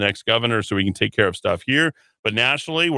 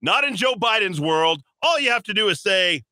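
ordinary talk, nothing marked

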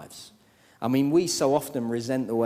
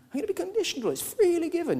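mock objector; faster than usual.

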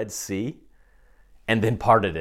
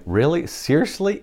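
Really? Seriously?, pitched way up, disbelieving; incredulous surprise; 8 semitones higher than usual. Retold or imitated. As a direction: voice leaps much higher in disbelief. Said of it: "being one of the three hundred people and going".

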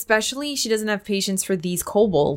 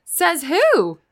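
mocking challenge; 10 semitones higher, 3 dB louder than usual. Marin challenging Akreya.

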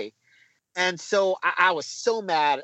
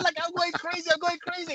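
high, softer re-enacted excitement; giddy high-pitched replay of celebrating; retold frenzy; 11 semitones higher, 4 dB quieter than usual. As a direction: relive going wild, voice pitched way up. Re-enacting his wild goal celebration.

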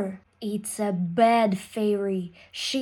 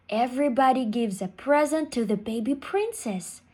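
usual speaking voice

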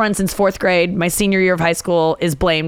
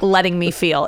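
normal talking voice

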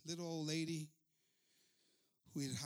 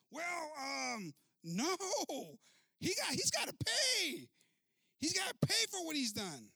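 high, loud, slow mock insistence; stubborn insistence; 10 semitones higher, 7 dB louder, slower than usual. Pastor insisting to the secretary he must pay. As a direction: dig in like a spoiled child.

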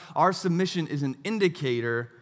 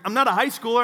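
loud, higher, indignant retort; retold yelling back; 7 semitones higher, 7 dB louder, faster than usual. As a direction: call back defiantly across the water. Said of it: "And I yelled back".

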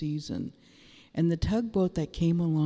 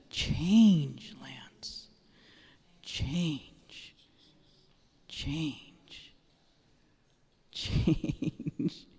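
whispered prophetic word; much slower than usual. Real, not acted. Speaking a prophetic word over Lance, fading whisper. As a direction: let each "Change" fade into a hushed whisper.

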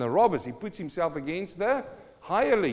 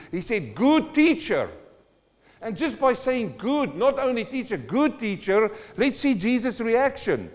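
high, loud revival preaching; preaching at full force; 7 semitones higher, 7 dB louder than usual. Acted. Preacher retelling Jesus and the rich man, high and loud. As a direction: lift the whole room with it. Sustained preaching on Mark ten.

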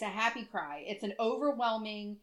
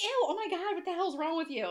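shocked retort; 9 semitones higher than usual. Retold or imitated. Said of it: "And I was like".

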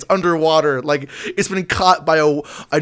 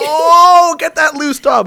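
higher, louder pretend yelp after the hot dog; mock panicked yell; 12 semitones higher, 6 dB louder, slower than usual. Imagined picnicker chasing a dropped hot dog, loud mock yell. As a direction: yell like the hot dog just got away.

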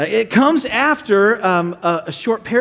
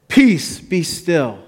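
steady, measured authority, falling away; calm command; much slower than usual. Acted. Jesus speaking to wind and waves, calm command.

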